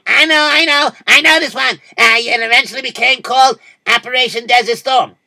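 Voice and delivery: high, loud know-it-all blurting; eager interruption. Acted. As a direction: jump in eagerly with the answer. Other voice butting in eagerly.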